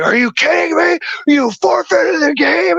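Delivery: imitated drunk rage; yelling in my face. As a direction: spit it into his face. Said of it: "in my face".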